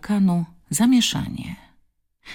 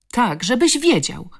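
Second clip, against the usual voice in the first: higher-pitched defiant cry; defiant shout; 6 semitones higher, 4 dB louder than usual. A narrator's reading. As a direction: shout back at the bully. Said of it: "krzyknęła z całych sił".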